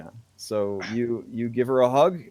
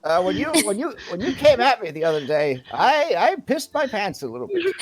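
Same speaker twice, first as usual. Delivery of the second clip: much higher and louder, comic squawk; flustered elder's confession; 8 dB louder, faster than usual. Acted. Elder admits to Toothless being scared of him, high loud fluster. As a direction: blurt it out in a high, loud character voice.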